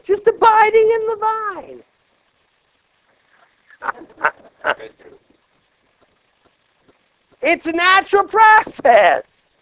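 high, loud, joyful preaching; exultant sermon climax; preaching at full force. Acted. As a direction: lift it high and celebrate. Preacher peak on abiding.

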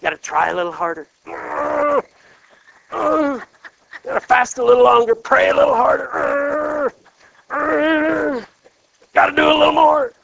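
mock anxious striving, rising to loud pleading; striving grapes. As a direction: ham up the strained effort of trying harder. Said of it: "They were all going".

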